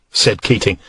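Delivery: usual speaking voice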